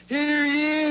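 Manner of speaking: mock court crier announcing the judge; town crier call.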